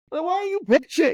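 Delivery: high, loud, exasperated snap; exasperated retort. Retold or imitated. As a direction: throw it back at her, half-joking. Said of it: "I'm like".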